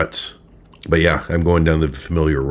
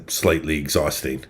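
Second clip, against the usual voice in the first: normal talking voice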